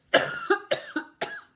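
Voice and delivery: sudden sharp cough; coughing hard, can't stop; loud coughing fit. Real, not acted. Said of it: "And I'm gonna sneeze".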